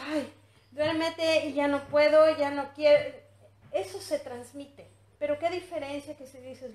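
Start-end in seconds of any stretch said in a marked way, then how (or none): 0.1-3.1 exasperated plea. Pretend. Acted mother losing patience.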